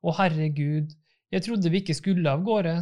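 usual speaking voice

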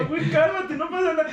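high-pitched imitation of a friend saying calm down; mock calming friend. Retelling how his friend calmed him, high mock voice. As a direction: playfully mimic a friend soothing you. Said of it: "Y me dijo".

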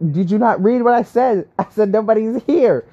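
acting out the frustrated comeback she never sent; frustrated retort. Venting about the empty store, mock frustrated retort. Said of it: "I didn't say that, but it was just frustrating".

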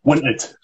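WINTED with softened T's; isolated, careful WINTED; Scots pronunciation demo. Shown as a demonstration. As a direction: model WINTED on its own, Scots vowel in the first syllable. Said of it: "If you're not gonna pronounce the T's".

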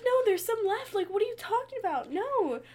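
high, loud indignant protest. Retold or imitated. Retelling the brownie argument with mom, high and loud. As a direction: insist there's some left, squealing. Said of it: "I was like".

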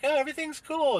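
high sing-song mock of someone brushing it off; mocking carefree voice; mocking dismissive voice. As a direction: sneer it up high like a naive optimist. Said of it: "people just have the audacity to be like".

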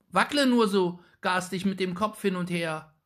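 put-on high, loud, fretful boy's voice; a small boy calling out nervously; anxious boy. A narrator's reading. Little Jakob crying out anxiously at the witch, high and loud. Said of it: "rief der Kleine ängstlich".

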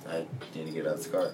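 low, soft and quick deadpan; mock-nervous aside under the breath; hushed inner thought. Retelling nervous thought facing cops.

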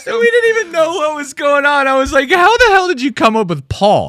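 high, laughing disbelief while still cracking up; laughing through it. Real, not acted. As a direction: spill the words out between laughs. Laughing while retelling confusion.

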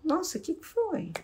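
soft concerned question to an upset partner; gentle caring question. Shown as a demonstration. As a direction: sound truly concerned for them. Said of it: "tem gente que pergunta assim".

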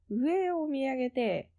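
ordinary talk, nothing marked

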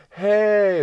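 very high, loud mock greeting; cheerful visitor. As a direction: call out a bright hey from the doorway. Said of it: "they're like".

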